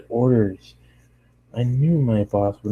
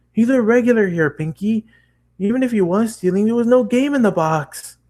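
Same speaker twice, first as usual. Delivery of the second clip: raised explaining; 12 semitones higher than usual. Acted. Narrator explains to pony.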